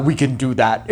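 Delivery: low, soft imitation of Arnold's Terminator voice; a light take on Arnold's deep monotone; mock Arnold line, low and quiet; Arnold's real voice. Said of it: "it's actual Arnold Schwarzenegger's voice".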